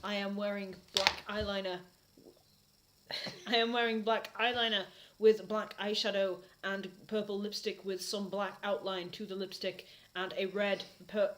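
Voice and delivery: ordinary delivery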